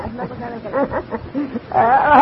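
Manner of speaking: a short, quiet real laugh; soft chuckle. Real, not acted. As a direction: chuckle softly, amused. Amused at the question.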